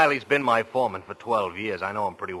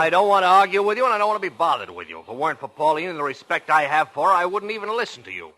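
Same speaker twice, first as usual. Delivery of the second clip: louder, higher, fed up; angry retort; 6 semitones higher, 6 dB louder than usual. Acted. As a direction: shut him down hard. Boss shouts back in quarrel.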